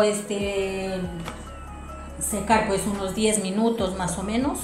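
ordinary delivery

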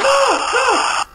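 high, loud cry of "no"; panicked high shout; startled scream. Real, not acted. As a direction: shriek it in sudden alarm.

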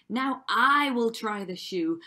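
loud, pushy sister voice; yelled demand. A narrator's reading. Second sister demanding her turn with the shoe, yelled demand. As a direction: yell it as the ugly sister. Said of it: "who yelled".

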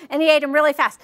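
ordinary talk

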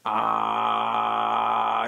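very low vocal fry ah; low throaty rasp. Shown as a demonstration. Voice tutor showing the throat rasp. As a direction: growl the ah from the back of the throat. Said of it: "see if you can kinda get to this point of".

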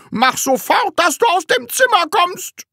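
high, loud enraged command; screamed order. Acted. Teacher throwing pupil out of classroom, screaming. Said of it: "schrie Herr Groll noch lauter".

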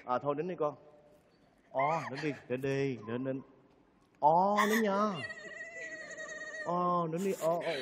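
low, soft hushing; soothing. Acted. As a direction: gently comfort someone who is crying. Soothing the crying young woman.

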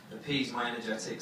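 normal talking voice

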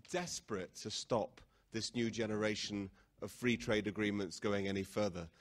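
ordinary delivery

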